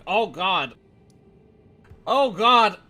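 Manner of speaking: loud, high panicked yell; panicked cry. Retold or imitated. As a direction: cry out as if overwhelmed. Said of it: "and like".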